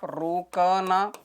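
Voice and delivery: "रुकना" broken slowly into syllables, रु-क-ना; sounded out slowly. Shown as a demonstration. Teacher explaining the meaning of विराम, "रुकना" sounded out slowly. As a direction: spell out "रुकना" by ear, syllable by syllable.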